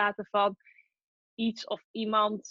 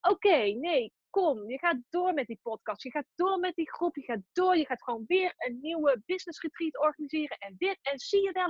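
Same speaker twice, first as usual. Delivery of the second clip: higher, insistent self-pep-talk; hyping herself up; 5 semitones higher than usual. Acted. Tired podcaster pushing herself to keep going, hyped-up and higher. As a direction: pile up the orders as if psyching yourself up. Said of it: "zeg maar, ophypen".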